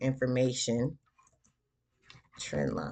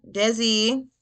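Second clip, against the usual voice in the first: loud call across the room, higher; 7 semitones higher, 6 dB louder than usual. Real, not acted. Mother calls out to baby.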